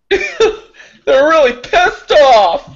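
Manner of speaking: high, drawn-out words through laughter; laughing. Pretend. Adding to the angry bee joke, laughing hard. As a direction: squeeze it out while cracking up.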